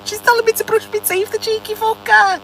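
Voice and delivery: high, squealing laughter-filled indignation; amused outrage. Real, not acted. Woman teased by her car about teeth, laughing indignantly. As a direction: laugh and squeal through the words. Laughs at car's cheeky advice.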